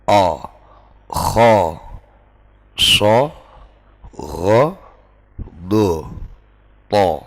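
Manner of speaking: O kho so ro do to, each said thick and heavy from the throat; heavy letter sounds. Shown as a demonstration. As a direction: demonstrate the heavy vowels. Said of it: "diperberat sedikit". (huruf tebal diucapkan berat)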